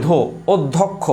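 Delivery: অধ্যক্ষ said as ওদ্ধোক্খো, with ধো stressed; ধো held and doubled inside অধ্যক্ষ, slowly shown; teaching demo. Shown as a demonstration. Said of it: "এই দেখেন ধো বলি". (শিক্ষকের মতো ধো জোর দিয়ে অধ্যক্ষ উচ্চারণ)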